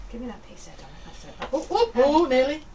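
ordinary delivery

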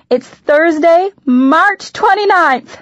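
high, loud, drawn-out excitement; gleeful near-scream. A narrator's reading. Mom revealing the big date to daughter, gleeful near-scream. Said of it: "she basically screamed".

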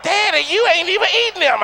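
put-on high child voice; little girl voice. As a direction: voice a small daughter.

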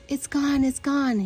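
high-pitched acted panic; crying out in alarm. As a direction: cry it twice, high and urgent. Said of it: "he cried".

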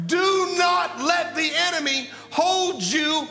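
full-force preaching. Acted. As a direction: rouse the church to wake up.

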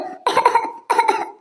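choking cough. Acted. Water got into her mouth.

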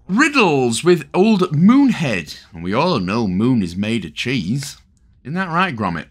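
put-on a lightly done, terrible Wallace and Gromit northern accent; hint of a Wallace and Gromit Lancashire accent. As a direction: badly mimic Wallace from Wallace and Gromit. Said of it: "terrible attempt there at a Wallace and Gromit accent".

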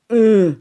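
sudden high loud yelp; startled yelp. Real, not acted. Player yelps at a risky jump, startled high yelp. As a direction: yelp as the jump nearly fails.

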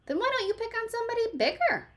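friendly bug dares the grouchy one; sweet retort. A narrator's reading. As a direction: tease back brightly. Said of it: "friendly ladybug retorts".

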